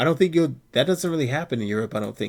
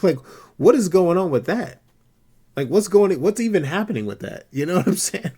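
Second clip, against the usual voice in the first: louder and higher, getting fired up; worked-up disbelief; 6 semitones higher, 5 dB louder than usual. Real, not acted. Ranting to a friend about people mocking his skin, louder and higher. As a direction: rant in growing disbelief.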